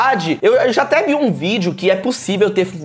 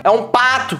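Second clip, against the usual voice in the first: duck imitation. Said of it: "preciso parar de tentar fazer imitação".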